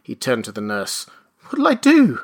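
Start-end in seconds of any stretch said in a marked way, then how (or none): 1.5-2.2 high, loud anxious question; flustered appeal. Acted. Father turns to the nurse, flustered. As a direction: plead helplessly, pitched up.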